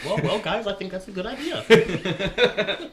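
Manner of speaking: high, slow, laughing put-on voice; hammed-up timid sidekick, laughing; laughing mock voice.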